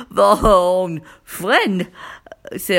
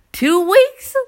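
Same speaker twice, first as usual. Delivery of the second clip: two weeks pitched high and loud; exasperated high emphasis; 12 semitones higher, 6 dB louder than usual. Real, not acted. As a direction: stress two weeks in disbelief.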